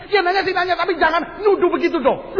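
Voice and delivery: high-pitched defensive retort; mock an indignant husband; irritated husband. Husband retorts in acted quarrel.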